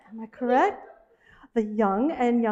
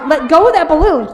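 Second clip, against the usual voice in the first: half-laughing, much louder command; laughing loud scold; 4 semitones higher, 10 dB louder than usual. Acted. Ordering Robbie to release the balloon, loud laughing scold. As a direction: yell at him through a laugh.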